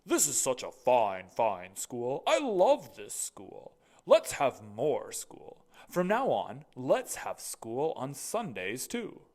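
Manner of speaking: loud, slow, proud school principal; booming headmaster. Acted. Mister Keene's voice.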